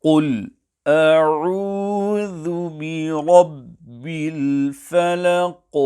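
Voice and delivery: measured tajweed recital; Quran recitation. Shown as a demonstration. Recites the verse.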